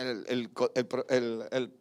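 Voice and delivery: normal talking voice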